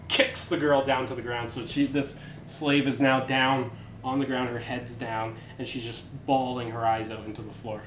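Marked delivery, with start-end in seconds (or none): none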